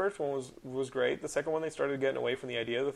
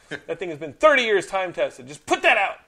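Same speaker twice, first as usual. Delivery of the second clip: much louder frustrated rant; furious fan ranting about the movie; real anger; 5 semitones higher, 9 dB louder than usual. Real, not acted. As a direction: vent at the studio. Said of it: "I get so mad".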